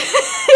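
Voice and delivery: high squealing laugh; real laugh bursting out; sharp burst of laughter. Real, not acted. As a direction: break into a loud shriek of laughter. Laughing at the joke.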